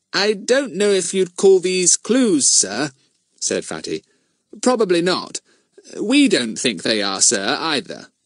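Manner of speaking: innocent with a twinkle; sly polite. Acted. Fatty sly.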